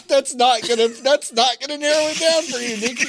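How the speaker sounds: high-pitched laughing retort. Real, not acted. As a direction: laugh while teasing her.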